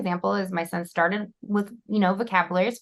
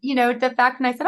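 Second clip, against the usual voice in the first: normal talking voice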